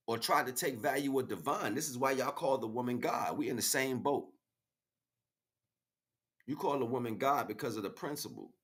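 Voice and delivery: ordinary talk, nothing marked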